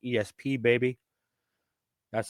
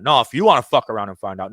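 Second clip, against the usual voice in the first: louder, heated warning on "wanna"; passionate outburst; 8 dB louder than usual. Real, not acted. Host warns the government, louder heated outburst. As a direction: let the anger push the volume up. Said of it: "a little bit of a passion here".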